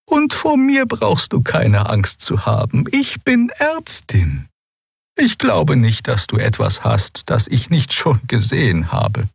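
put-on high-pitched old creature voice, gentle; calm a nervous patient in a high character voice; gentle, high elderly doctor; reassuring old healer.

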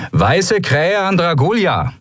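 high, slow radio hail; calling out. A narrator's reading. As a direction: hail another ship, drawn out and high. Radio hail between ships.

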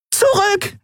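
very high panicked cry; panicked scream. Acted. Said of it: "schrie sie panisch".

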